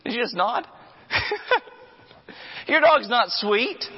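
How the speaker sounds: a preacher teasing the congregation about the sweet dog; incredulous mock disbelief. Real, not acted. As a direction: needle the owner with high incredulous questions. Mocking the dog owner.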